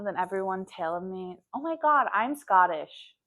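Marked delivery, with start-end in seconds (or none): 1.5-3.2 loud mock North American accent; loud, put-on North American voice; imitated North American accent. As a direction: mimic a gushing North American claiming Scottishness. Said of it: "with that accent".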